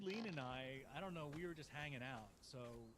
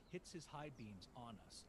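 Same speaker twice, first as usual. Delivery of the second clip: normal talking voice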